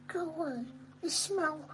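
squeaky high dog voice; dog's voice. Pretend. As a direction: voice the dog insulting you. Voicing the dog.

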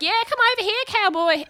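high, playful mock invitation; jokey come-on. [name] jokingly offers the man a kiss, playful come-on. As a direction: imitate a mate egging someone on.

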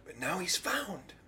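hushed, voiceless whisper; awed whisper. Acted. Father marveling at his returned son, whispered. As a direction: let it out as a soft whisper.

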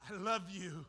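tender God voice. Acted. God speaking love to the sinner, softly and tenderly. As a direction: hush it like a loving whisper from God. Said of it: "when God said".

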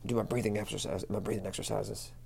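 ordinary talk, nothing marked

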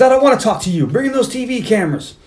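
high-pitched agitated gunman imitation; mock ranting villain. Bad guy demanding TV cameras, high mock rant. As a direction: make demands in a high, worked-up voice.

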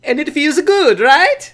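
hammed-up Italian accent, high and loud. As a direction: play a broad cartoon Italian. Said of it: "make this offensive Italian accent".

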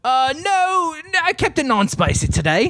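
chirpy mock Brit declining spice; attempted British accent. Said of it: "sound a little Australian or New Zealand".

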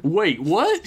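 realising, indignant; sudden indignant realisation. Acted. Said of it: "suddenly remembering suffrage".

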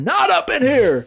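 high, drawn-out protest; hammed-up territorial refusal; mock defiant outburst. Resident refusing to allow break-ins, high defiant outburst. Acted defiance against neighborhood intruder.

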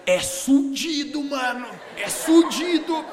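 "sudido" dito bem devagar, com ênfase; "sudido" troca de sílaba. Retold or imitated. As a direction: diga "sudido" trocando a primeira sílaba, arrastado. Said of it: "eu vou mudar a primeira sílaba". ("sudido" no lugar de "sucedido", lento e enfático)

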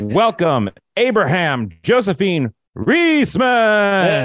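high, slow, drawn-out ring intro; ring announcer. Acted. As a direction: stretch the name like a wrestling announcer. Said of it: "the ring announcer voice here".

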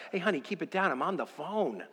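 mock phone-side hush; hushing father. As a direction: imitate a dad quietly shushing. Father voiced hushing his daughter.

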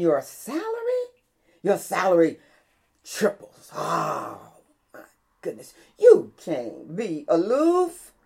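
punched, stretched sermon phrases; emphatic pulpit exhortation; fervent preaching. Real, not acted. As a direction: preach it with mounting amazement. Preaches with rising fervour.

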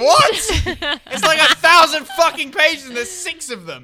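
high, loud, drawn-out disbelief; astonished high-pitched rant; incredulous outburst. Real, not acted. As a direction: shout it in amazement. Incredulous at her recall.

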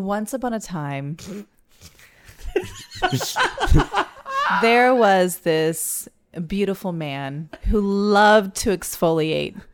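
slow storybook narration; drawn-out once upon a time; fairy tale opening. Acted. Starting a story game.

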